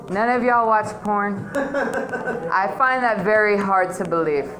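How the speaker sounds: usual speaking voice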